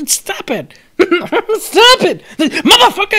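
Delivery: high, loud mock yell cracking into laughter; mock doughboy rage. Reviewer laughs while voicing the punched minion's high yells. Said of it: "the Pillsbury Doughboy got sick of your shit".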